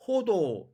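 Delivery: 호도 with a light, unrasped h; Korean reading. Shown as a demonstration. Teacher reading the words in Korean, Korean reading. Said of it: "한국말로 읽으면".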